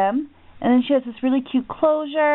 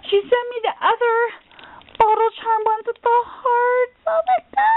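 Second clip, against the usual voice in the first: much higher, rising squeal; excited; 10 semitones higher than usual. Real, not acted. Telling viewers her friend sent both, squealing. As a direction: climb up into a squeal of joy. Gets the charm she wanted.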